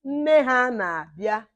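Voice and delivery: Nne ha na-abịa spoken slowly, rising on nne; drawn out so students can follow; slow model phrase. Shown as a demonstration. Teacher slows down Nne ha na-abịa for the class to copy. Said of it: "Detuo ya".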